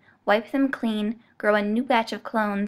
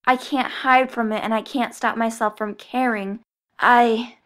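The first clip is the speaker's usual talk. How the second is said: pained, hurting; 6 dB louder, slower than usual. Acted. Clone in pained confusion.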